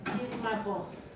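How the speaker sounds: usual speaking voice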